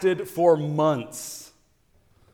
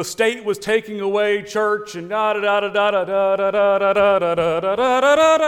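louder and higher, rattling off nonsense syllables; mock impassioned rant; 7 semitones higher, 7 dB louder than usual. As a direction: parody a fired-up speech with da da da. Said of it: "gave a very impassioned, uh, you know, speech".

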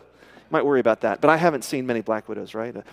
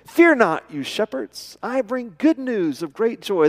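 heralding angel; 7 semitones higher, 4 dB louder, slower than usual. Acted. Angel's announcement to the shepherds.

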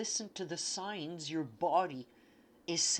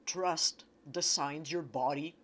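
usual speaking voice

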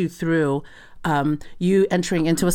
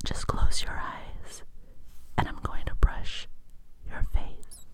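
slow soothing whisper; whispered asmr role play; 7 dB quieter, slower than usual. Shown as a demonstration. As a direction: lull them with a slow whisper. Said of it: "And then it'll be like this".

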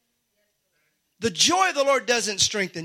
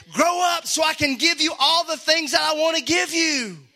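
higher, impassioned urging; acted eager generosity; 7 semitones higher, 4 dB louder than usual. God longing to give gifts to his child, acted eagerness. God's eager voice against the scolding.

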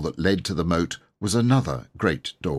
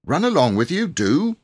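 higher, louder fussing cook; busy cook; 9 semitones higher, 5 dB louder than usual. A narrator's reading. The cook chases the children out while cooking, loud and higher. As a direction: shoo them out of the kitchen. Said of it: "she said".

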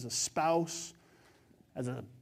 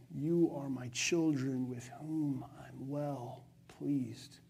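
tender father; 6 semitones lower, 9 dB quieter, slower than usual. Retold or imitated. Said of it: "And he says".

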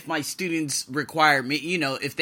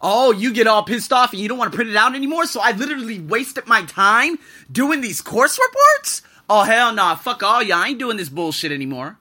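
higher, louder furious telling-off, building to a blast; angry retort; 5 semitones higher, 5 dB louder than usual. Retold or imitated. Teacher telling off the head teacher, raised and furious. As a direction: let him have it, voice raised high. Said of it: "And I was like".

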